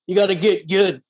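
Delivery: a hint of a taunting boss voice; Dark Souls character. Gamer friend channels a Dark Souls character, mildly mocking. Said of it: "channel my inner, uh, Dark Souls, uh, character".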